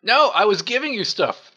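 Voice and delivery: loud, unvoiced frantic protest; panicked protest. Real, not acted. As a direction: yell out a frantic denial. Panicked protest at thief label.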